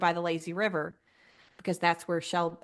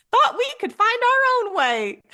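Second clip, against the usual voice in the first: put-on much higher, louder little child's voice; little girl voice; 8 dB louder than usual. Mom imitates her little daughter's cute excuse, high child voice. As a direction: squeak it like a tiny Southern girl. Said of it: "says in her little voice".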